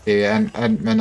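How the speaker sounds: normal talking voice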